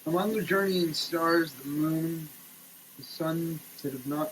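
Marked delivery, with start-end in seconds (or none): none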